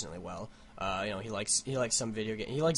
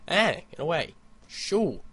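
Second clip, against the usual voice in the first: high, louder shoo at a bug; 7 semitones higher, 7 dB louder, slower than usual. Real, not acted. Vlogger shoos a fly off his screen, raised voice.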